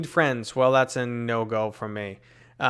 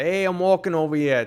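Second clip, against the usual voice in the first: loud, higher mock Brooklyn tough guy; 6 semitones higher, 6 dB louder than usual. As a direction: ham up the Brooklyn street accent. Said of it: "people wanna say".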